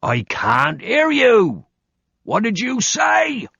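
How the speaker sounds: mock drill-sergeant challenge.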